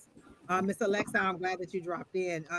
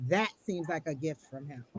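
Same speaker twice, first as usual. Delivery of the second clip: ordinary talk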